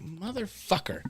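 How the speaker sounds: soft slow exasperated curse; muttered curse. Retold or imitated. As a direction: breathe the curse out slowly.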